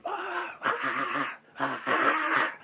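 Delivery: breathy then loud ah ah, mimicking dogs lunging; dog snarls imitated. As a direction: huff out short animal bursts. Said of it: "they're going like that".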